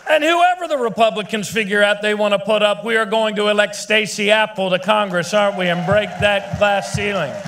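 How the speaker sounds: high, loud campaign crescendo; rally shout. Real, not acted. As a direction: build the crowd to a roar. Rally crescendo naming candidates.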